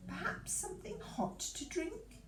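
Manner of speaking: high, soft, sugary offer; honeyed offer. A narrator's reading. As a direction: tempt him sweetly. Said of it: "said the Queen".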